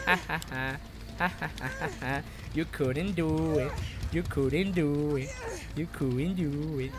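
low, quiet mocking chant; soft, low gloating; muted taunting. Real, not acted. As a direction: tease the opponent under your breath. Muttered taunt at the opponent.

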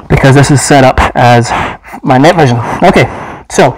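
muffled behind rubber facepiece; speaking through mask voicemitter; through a gas mask. Real, not acted. Seen 4: Full-face gas mask worn throughout; reference frames show him unmasked.

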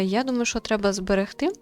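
ordinary talk

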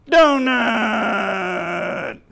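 high, loud, drawn-out puppet shout; long, falling puppet holler; puppet character. Acted. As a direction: blurt it out in a silly high puppet voice. Puppet Mikey blurts wrong answer.

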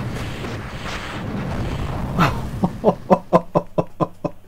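airy disbelieving laugh; breathy laugh. Real, not acted. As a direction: laugh it off under your breath. Laughs after dying. (rire soufflé)

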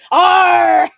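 loud, high pirate "arr". Acted. Streamer answering viewer's pirate remark, loud pirate cry. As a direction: belt out a hammed-up pirate growl-word. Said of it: "we both sound like pirates".

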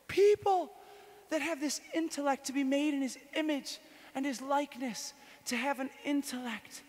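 high, loud, driving sermon voice; fervent preaching. Real, not acted. Teaching a crowd about the Trinity, fervent preaching. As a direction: preach it with full fire.

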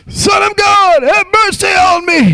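high crying-out shout for mercy; desperate shouted plea; blind man's shout. Retold or imitated. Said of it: "he's like".

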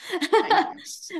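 high, laughing thank you; giggly grateful thanks. Real, not acted. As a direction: thank her through a laugh.

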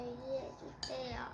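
soft slow whisper. Acted. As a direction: breathe the plea in a hush. Child pleads after spilling food.

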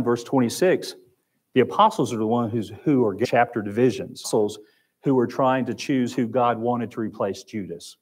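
normal talking voice

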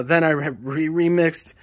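usual speaking voice

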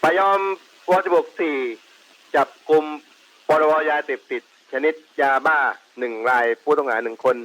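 radio transmission voice; over walkie talkie. Real, not acted. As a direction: speak through a handheld police radio. Field unit reports over radio. (เสียงผ่านวิทยุสื่อสาร)

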